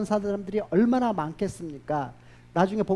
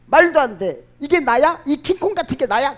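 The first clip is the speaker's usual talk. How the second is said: louder, rising disbelief at her own face; shocked outburst; 6 semitones higher, 8 dB louder than usual. Acted. Chief's wife shocked by her reflection in the mirror, loud disbelief. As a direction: blurt it out, horrified by the mirror.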